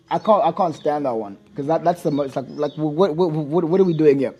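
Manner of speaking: ordinary talk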